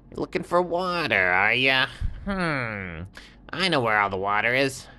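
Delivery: high, sly little scorpion; high little scorpion voice, offering help. Acted. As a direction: squeak it out like a small sly critter. Scorpion voice in story.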